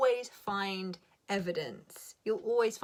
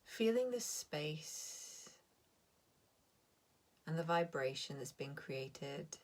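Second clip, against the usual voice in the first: guided meditation; 5 dB quieter than usual. Acted. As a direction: ease the listeners down into calm. Leads a meditation.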